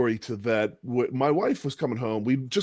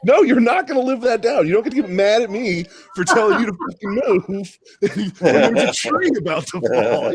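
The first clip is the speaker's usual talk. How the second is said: loud, high-pitched indignant rant; indignant scolding; 8 semitones higher, 5 dB louder than usual. Acted. Husband telling off his wife over the falling tree, indignant and loud. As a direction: scold through your own laughter, voice pitched up.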